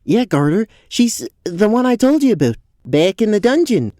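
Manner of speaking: high-pitched, eager goat-man voice; keyed-up, pitch climbing high; excited explanation. Acted. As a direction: explain eagerly to your friend. Rael excitedly explains to friend.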